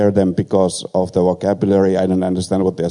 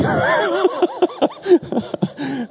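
laughing till almost crying; wheezing high laugh. Real, not acted. Preacher laughing at his own story, near tears.

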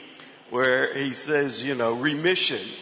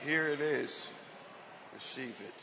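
softer, tender handing over; gentle offering; 10 dB quieter than usual. Retold or imitated. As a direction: offer it quietly, freely. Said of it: "he's just like".

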